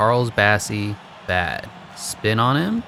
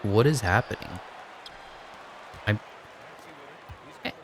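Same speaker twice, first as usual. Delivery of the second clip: usual speaking voice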